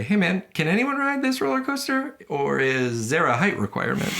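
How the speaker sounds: high, excited theme park customer voice; a customer asking the ride worker, high pitched; acted customer. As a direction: pitch way up like an eager rider. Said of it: "trying to, now I'm trying to copy you".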